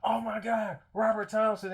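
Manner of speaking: playing the PAs freaking out over a star; starstruck squeal. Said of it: "and was like".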